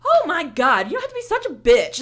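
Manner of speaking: loud, high, fast outburst; yelling at the rude security lady; heated insult. Retold or imitated. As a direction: snap it at her in full fury. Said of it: "it got me so heated".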